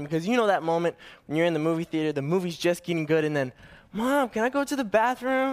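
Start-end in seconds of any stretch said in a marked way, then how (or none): 3.9-5.5 put-on whiny kid. Kid interrupting the movie.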